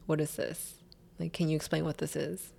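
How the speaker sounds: soft, controlled confrontation; very calm. Retold or imitated. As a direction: ask it quietly, holding anger in. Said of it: "I very calmly".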